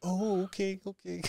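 high, hushed, backing-off reply; mock meek acceptance. Onlookers accepting that the dog is trained in German, mock meek.

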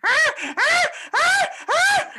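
very high, loud repeated shrieks; mock screeching cries. Listener acting out shocking monkey audio, mock screeching.

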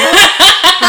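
high, loud laugh; burst of laughter. Real, not acted. Co-host learning something new live, bursting out laughing. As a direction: crack up out loud. Surprised by what she just learned.

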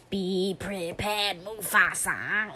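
slow, nasal crooning; nasal old lady voice. Pretend. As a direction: stretch it out like a stuffy old lady.

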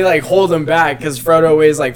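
ordinary delivery

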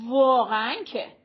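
loud exasperated outburst; almost shout it, short and loud; near shout. A narrator's reading. Arezu rebuking her friend in the street, nearly shouting. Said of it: "آرزو تقریباً داد زد".

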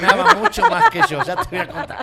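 high loud laugh through the line. Real, not acted. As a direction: burst out laughing as you say it.

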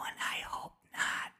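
hushed, voiceless whisper; retold whisper. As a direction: whisper it low, under the breath. Retold whispered quote in story.

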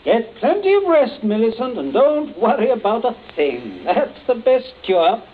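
high, loud cheerful reassurance; hearty advice. Acted. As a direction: send her off with breezy good cheer. Hearty advice to his daughter.

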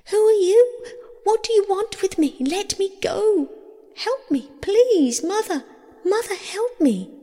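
high-pitched panicked plea; frightened cry. A narrator's reading. Girl seized by Pluto calls for mother, panicked cry. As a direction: cry out for help, very high and urgent. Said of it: "Persephone cried".

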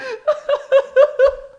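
high shrieking laughter; helpless giggling fit; hysterical laugh. Real, not acted. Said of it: "Why are you laughing so hard at this?".

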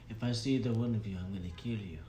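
put-on low, quiet Italian-style accent threat; muttered threat in a shaky attempt at Italian accent; put-on foreign accent. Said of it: "He did not have a Russian accent".

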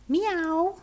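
high rising cat meow; a cat answering the sexton. A narrator's reading. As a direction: mew like an old tomcat. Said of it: "said old Tom in answer".

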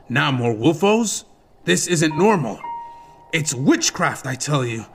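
a lightly voiced, uneasy guard; a hint of a superstitious guard spooking himself. A narrator's reading. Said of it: "one of the guards swore".

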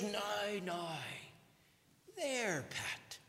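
soft, hushed mother's hush; a hint of a mum calming a crying baby; mother soothing. Preacher voicing a mother soothing her crying baby, softly. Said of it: "and she says".

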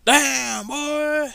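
loud, high hype exclamation; mock meme shout. Meme quote repeated.

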